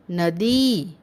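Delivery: नदी with the final ee held long; drawn-out teaching demo of नदी. Shown as a demonstration. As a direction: stretch the दी in नदी. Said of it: "तो उच्चारण थोड़ा लंबा हुआ".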